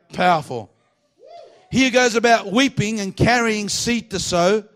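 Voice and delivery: slow, high-pitched, weighted proclamation; emphatic preaching. Real, not acted. Preacher repeating the psalm to drive it home, slow emphatic preaching. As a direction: space the verse out and lean on the key words.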